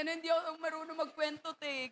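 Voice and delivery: soft, fast shy mumble of a friend; quiet shy quote. Retold or imitated. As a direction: mumble it softly like a shy girl. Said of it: "Sabi ni Ate Thea". (mahinang nahihiyang pagsasalita)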